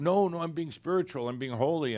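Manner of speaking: mock protest. Human caught in the web protesting they're spiritual, mock protest. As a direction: imitate someone insisting on their holiness.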